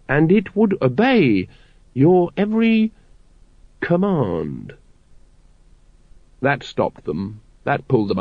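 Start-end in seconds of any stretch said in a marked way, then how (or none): 0.1-4.7 tempting Grand Master. A narrator's reading. Grand Master tempting the brethren with power, silkily. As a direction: dangle it like bait.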